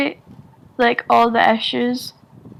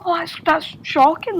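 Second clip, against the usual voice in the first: higher, lightly shocked reaction; mock shock; 6 semitones higher than usual. Imitating listeners startled by the Scottish accent, mock shock. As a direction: imitate someone taken aback by an accent. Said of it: "my accent is so out there".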